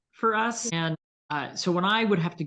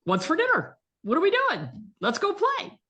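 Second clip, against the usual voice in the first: high, louder happy dog voice; the dog only thinking of dinner and play; very high, bouncy dog voice; cheerful dog voice; 3 dB louder than usual. Pretend. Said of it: "And your dog's like".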